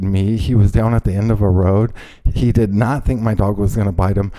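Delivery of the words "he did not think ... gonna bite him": ordinary delivery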